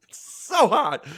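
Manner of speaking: high, loud laughing yelp; hammed-up pained outburst; mock burning pain. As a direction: squeal it as if being scalded. Acts out hot cheese burning legs.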